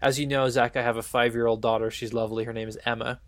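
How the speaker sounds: normal talking voice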